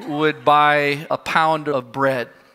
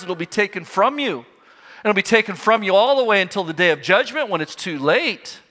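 higher-pitched, urgent reproach; prophet's rebuke; 6 semitones higher than usual. Acted. Daniel confronting Belshazzar over what he knew, urgent higher-pitched rebuke. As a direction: confront the king in a raised pitch. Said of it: "He says, Daniel says".

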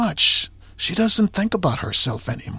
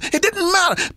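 It didn't matter high and ringing; climactic refrain; 12 semitones higher than usual. Real, not acted. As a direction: lift It didn't matter to the top. Refrain peaks in pitch.

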